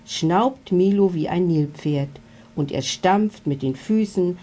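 ordinary delivery, nothing marked

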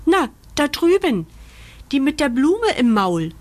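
high, pressing call; impatient. A narrator's reading. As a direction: point it out with growing impatience. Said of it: "ruft Murks ungeduldig".

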